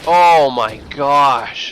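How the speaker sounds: drawn-out, loud gasp of amazement; stunned. Real, not acted. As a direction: let the surprise stretch out slow. Caster stunned by the play.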